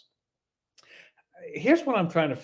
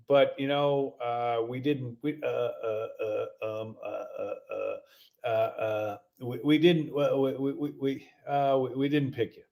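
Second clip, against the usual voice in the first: low mumble of uhs, stuck on we; mocking a caller who can't get it out; halting bad-news caller; 5 semitones lower than usual. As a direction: stammer repeatedly before finally delivering the rejection. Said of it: "this is how this guy talks".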